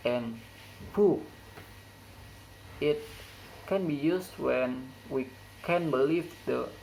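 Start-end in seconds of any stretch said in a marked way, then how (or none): 1.0-1.2 "book" said with a sharp rise; high-rise intonation. Shown as a demonstration. Teacher showing where the high rise occurs, "book" rising sharply. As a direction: lift "book" like a surprised question. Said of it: "The high rise intonation occurs in word".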